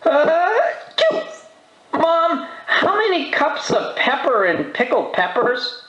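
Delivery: put-on high-pitched kid asking Mom; young boy voice. A narrator's reading. Arthur asking Mom about his recipe, high boy voice. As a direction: call to Mom in a high boy's voice. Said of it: "he asked".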